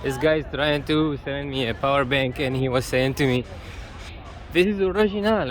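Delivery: ordinary delivery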